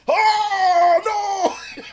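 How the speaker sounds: high, loud hammed-up wail; mock anguished cry. Streamer acting the losing fighter's despair, wailing. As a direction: wail it like a beaten anime hero. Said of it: "and then the person's just like".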